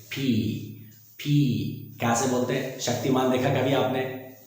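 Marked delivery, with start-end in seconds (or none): 0.0-2.0 पी with a puff of air, 'phee'; aspirated पी; between P and PH. Shown as a demonstration. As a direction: say पी halfway between पा and फा. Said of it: "पा और फा के बीच वाला sound".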